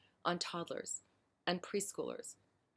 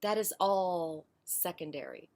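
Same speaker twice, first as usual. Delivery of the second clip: ordinary talk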